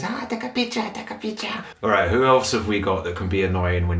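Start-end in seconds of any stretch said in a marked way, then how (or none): 0.0-1.6 high, squeaky mock tourist voice; shrill caricature pleading for photos. Mimicking tourists begging for a picture, high and squeaky.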